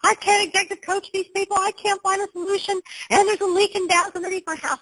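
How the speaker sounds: high, lightly whiny wail of despair, not really crying; imitating her despair. Coach retelling crying on the floor, high mock wail. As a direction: replay the breakdown in a mock wail. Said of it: "I was on the floor crying. I go".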